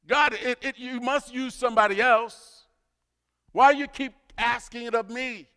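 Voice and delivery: mock complaint to God, pitched high; exasperated protest. Voices listeners' protest to God.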